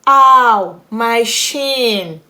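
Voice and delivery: high, loud cry of pain; pained yell. Acted. Child tripped and hit their leg hard, pained yell. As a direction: yell out as if you just hurt yourself. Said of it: "I yelled out".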